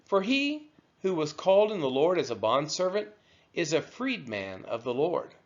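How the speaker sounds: ordinary talk, nothing marked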